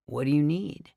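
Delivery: plain reading voice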